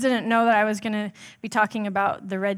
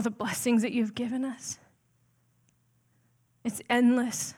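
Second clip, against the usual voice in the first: earnest prayer; 3 dB quieter than usual. Real, not acted. Pastor leads the congregation in prayer, soft and earnest. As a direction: pray quietly and sincerely, unhurried.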